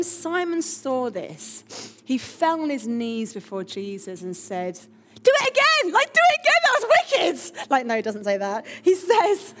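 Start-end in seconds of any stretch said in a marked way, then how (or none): 5.2-7.7 high, loud, gleeful shouting; over-the-top excited yell, far above normal pitch; mock thrilled. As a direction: whoop it like a kid wanting another go. Jokey acted thrilled reaction to miracle.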